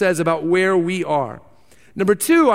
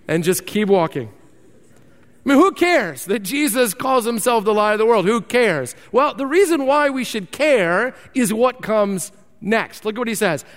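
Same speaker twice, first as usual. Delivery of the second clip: ordinary delivery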